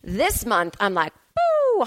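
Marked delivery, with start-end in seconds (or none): 1.3-1.9 hyper whoop. Pretend. Acting out being hyper.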